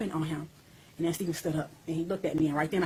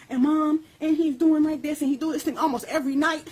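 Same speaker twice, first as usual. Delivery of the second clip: lightly voiced young son; 8 semitones higher, 5 dB louder than usual. Voicing young son's confession.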